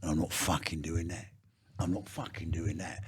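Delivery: low gruff mock of Vinnie refusing; lightly voiced hard man; mimicking Vinnie, low. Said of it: "and gone".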